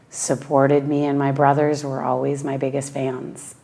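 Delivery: usual speaking voice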